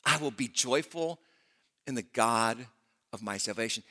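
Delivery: rising, sung-out preaching that builds then drops to a hush; preaching prayer. Acted. Preacher reads Habakkuk's prayer to the congregation, building then hushed.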